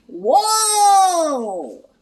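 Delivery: high cartoon whoa; startled whoa. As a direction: yelp as you lose your footing. Sliding off the path.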